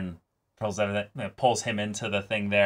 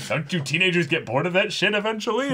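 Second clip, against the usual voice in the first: mock exasperated father; 9 semitones higher, faster than usual. Continuing father role.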